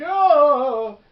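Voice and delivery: loud, high drawn-out "oh"; imitated cry of "oh". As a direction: act out a startled outcry. Retold reaction to the waking scarecrow.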